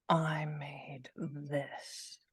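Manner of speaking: slow hushed whisper. Real, not acted. Showing viewers her cake, a slow whisper.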